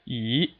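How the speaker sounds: E rising steadily; second tone. Shown as a demonstration. Teacher shows learners the second tone, steadily rising E. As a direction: slide E upward with no dip at all. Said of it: "a steadily rising tone".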